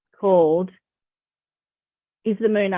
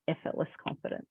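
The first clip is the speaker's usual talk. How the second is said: whispered; 4 semitones lower, 12 dB quieter, faster than usual. Acted. Stressing key words softly.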